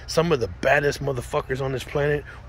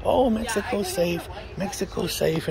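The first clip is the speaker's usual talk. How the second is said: mock reassurance; 7 semitones higher than usual. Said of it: "people will try to say".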